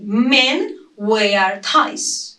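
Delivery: each word said slowly and clearly; slow, careful demo of "Men wear ties"; slow model sentence. Shown as a demonstration. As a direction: spell it out slowly for learners. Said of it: "I just say".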